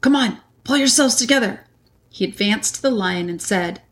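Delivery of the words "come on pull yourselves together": higher-pitched, urgent acted order; rally the others in character; hasty rallying. A narrator's reading. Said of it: "hastily saying".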